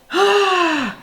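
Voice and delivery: loud, high exhaled sigh; big sigh. Real, not acted. Recapper pausing before the next part, big sigh. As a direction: let out a big audible sigh.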